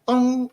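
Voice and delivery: sung dong, plucked and dying; a loud sung dong; harpsichord dong. Shown as a demonstration. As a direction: imitate a harpsichord note. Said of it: "the tone go like".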